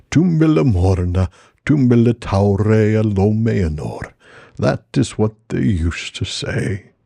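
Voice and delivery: slow, drawn-out Treebeard voice. Acted. Treebeard recalling the Golden Wood, slow and ponderous. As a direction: speak each word at an ancient Ent's unhurried pace.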